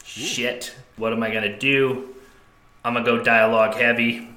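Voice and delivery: ordinary talk, nothing marked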